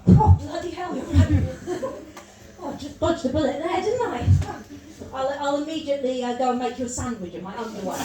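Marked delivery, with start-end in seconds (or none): 0.1-4.2 loud, high mock shock dripping sarcasm; sarcastic fake gratitude. Woman mocking a sexist man, loud and sarcastic. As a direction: gush in hammed-up mock relief. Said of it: "So I'll go".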